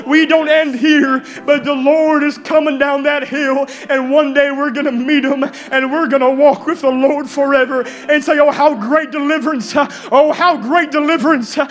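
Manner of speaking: exultant full-force sermon climax; fervent preaching. Acted. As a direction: proclaim it triumphantly to the whole church.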